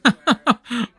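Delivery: high, giggly chuckle to oneself; amused chuckle. Acted. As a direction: chuckle along with a funny comic. Laughing to himself over comics.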